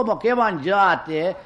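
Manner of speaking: ordinary talk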